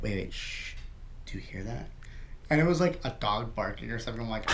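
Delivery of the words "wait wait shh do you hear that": very soft, low hush; barely voiced, secretive murmur; hushed alert. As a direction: hush your friend to listen for a sound. Hushing friend to listen.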